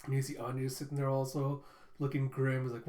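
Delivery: usual speaking voice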